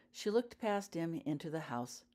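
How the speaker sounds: ordinary delivery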